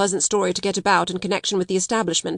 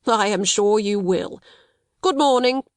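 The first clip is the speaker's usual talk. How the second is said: smooth reply with a little sinister smile; a touch of sly menace, understated; mildly sinister. A narrator's reading. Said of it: "with a little sinister smile".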